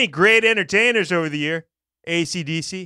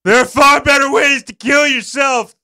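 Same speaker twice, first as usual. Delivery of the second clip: mock shout; 7 semitones higher, 7 dB louder than usual. Reading comic letter with gusto.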